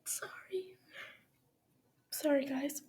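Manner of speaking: choked up, apologizing through tears; real crying. Real, not acted. Reader crying after the letter, apologizing to listeners tearfully. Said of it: "I'm crying right now".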